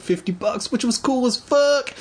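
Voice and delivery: high excited squeal. Real, not acted. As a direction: gush with delight. Excited thanks.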